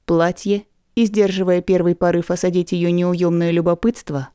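plain reading voice

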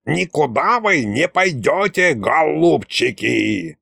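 slow, creaky villain drawing out the words; gloating creaky voice. A narrator's reading. Unseen ghost stopping the group, gloating creak. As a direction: gloat creakily over trapped prey. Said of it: "злорадный скрипучий голос".

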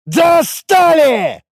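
very high, loud, long-stretched vowels; exasperated scream. A narrator's reading. Warrior reaching for his mace, drawn-out scream. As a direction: scream it out slowly, at breaking point. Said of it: "не прекращая кричать".

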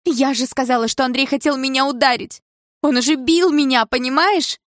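very high, loud protest; outraged shouting. A narrator's reading. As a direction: yell at mother in desperate indignation. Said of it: "закричала я так громко". (возмущённый крик дочери)

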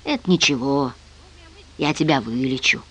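low, soft, lulling comfort; gentle reassurance, very soft; soothing. A narrator's reading. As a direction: hush him tenderly, keeping it low. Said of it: "убаюкивая".

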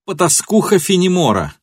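high, jeering outcry; mocking shout. A narrator's reading. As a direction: hurl the insult with derision. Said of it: "крикнула она ему с издёвкой".

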